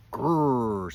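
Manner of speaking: loud drawn-out "Grrr"; rhinoceros growl. A narrator's reading. Rhinoceros threatening a small porcupine, growling. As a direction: growl loudly like a grumpy rhino. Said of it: "said the rhinoceros".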